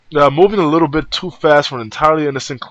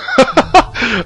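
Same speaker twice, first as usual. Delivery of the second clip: giggle; 10 semitones higher, 4 dB louder than usual. Acted. Clover laughs at Junpei.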